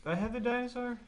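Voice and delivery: a high, startled question; surprised. Real, not acted. Co-host, surprised the dinosaur was in that one, asks high. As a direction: pitch jumps way up in disbelief.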